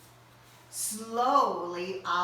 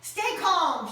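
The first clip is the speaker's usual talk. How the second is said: shout; 8 semitones higher, 6 dB louder than usual. Acted. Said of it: "shouted Owl".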